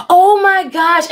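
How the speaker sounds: stunned at what pops up on screen; shocked exclamation. Real, not acted. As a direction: draw it out in real shock.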